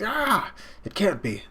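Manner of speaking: high mock cry of disbelief; acted shock. Dodging fighter can't believe it, acted high shock. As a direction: react in hammed-up, high-pitched shock.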